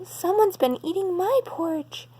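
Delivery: gentle lilting mother bear; mama bear voice. Acted. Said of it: "she explained".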